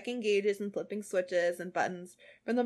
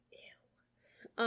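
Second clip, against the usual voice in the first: disgusted whisper; 8 dB quieter than usual. Real, not acted.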